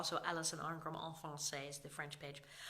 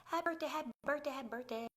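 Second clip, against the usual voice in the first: sing-song greeting; 7 semitones higher, faster than usual. Real, not acted. Greeting chat viewers.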